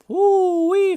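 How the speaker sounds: excited woo-wee. Real, not acted. Reacting to crazy clip.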